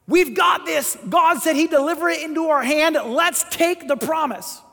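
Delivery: confident rallying cry, loud and high; fired up, ready to charge in; eager shout. Acted. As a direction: bold battle call. Two spies eager to take land.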